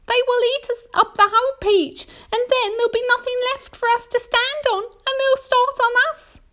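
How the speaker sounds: very high, loud panic; despairing Miss Spider. A narrator's reading. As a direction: cry out in high-pitched dread. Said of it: "cried Miss Spider".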